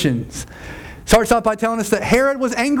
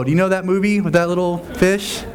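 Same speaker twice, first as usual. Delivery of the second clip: ordinary talk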